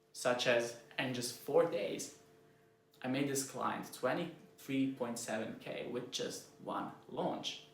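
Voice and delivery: slow right down and soften to land it. Shown as a demonstration. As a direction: results told slowly and quietly to impress a client. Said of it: "way softer and way slower".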